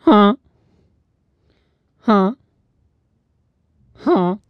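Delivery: high, blunt mock "Huh?"; mock confused huh. Mocking how Americans ask again, mock confused huh. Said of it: "solo pueden decir".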